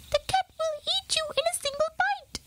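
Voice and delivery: fast, high warning mouse; alarmed old mouse. A narrator's reading. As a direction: rush out the warning, squeaky. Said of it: "he exclaimed".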